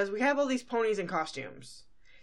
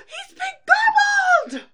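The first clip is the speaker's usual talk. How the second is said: much higher, much louder, slow wail; panicked yell; 13 dB louder, much slower than usual. Retold or imitated. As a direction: wail it in cartoon terror. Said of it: "sees this and yells".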